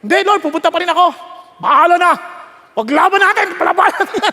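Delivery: high, loud, fast refusal; hammed-up defiant shout; hypothetical defiance. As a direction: play a stubborn fighter answering back. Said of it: "malakas pa siya".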